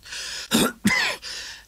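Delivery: dry rasping cough. A narrator's reading. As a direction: clear the throat with a rough cough.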